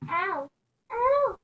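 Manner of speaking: sudden high yelp of pain; a sharp, high cry as something hits you. Real, not acted. Hit by falling socks.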